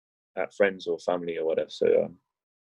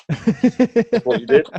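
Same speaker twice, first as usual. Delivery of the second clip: high, slow mocking imitation; mimicking friend; 14 semitones higher, 6 dB louder, much slower than usual. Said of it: "I can mimic you".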